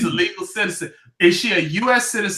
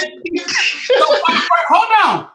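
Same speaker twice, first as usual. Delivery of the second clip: 1 loud interruption; 10 semitones higher, 3 dB louder, much slower than usual. Real, not acted. Host cuts in, arguing loudly.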